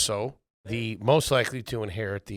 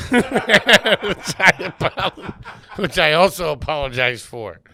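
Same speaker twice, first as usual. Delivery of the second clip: laughing; 6 semitones higher, 5 dB louder, slower than usual. Real, not acted. Laughing through apology.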